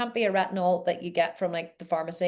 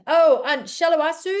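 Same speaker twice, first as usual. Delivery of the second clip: ordinary talk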